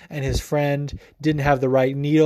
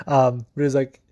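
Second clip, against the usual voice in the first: usual speaking voice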